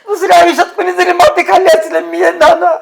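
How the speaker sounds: wailing, tearful hurt; crying. Acted. Betrayed man telling Nana about his friend Amir, crying. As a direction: lament the betrayal through sobs. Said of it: "अरे रडू नको".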